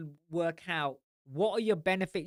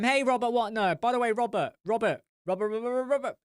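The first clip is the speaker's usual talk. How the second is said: louder, higher repeated calling; 6 semitones higher, 3 dB louder, faster than usual. Real, not acted. Calling to Robert in the live chat, loud.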